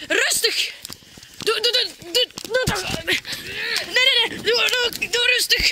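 high, frantic stammering; panicked pleading. Acted. Victim telling attacker to calm down, high panicked plea. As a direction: beg the attacker to calm down, voice climbing.

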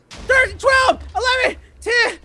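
high, excited shouted countdown; tense countdown. Real, not acted. Hider counting last seconds, high excited shouting. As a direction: count down frantically, nearly there.

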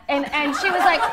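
ordinary delivery

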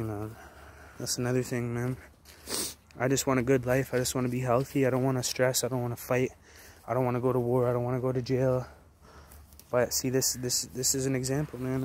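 normal talking voice

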